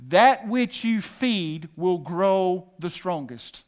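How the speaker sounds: "That which you feed" posé mot à mot, très lent; slow solemn statement. Shown as a demonstration. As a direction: lay down each word like a verdict. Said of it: "Here's the word".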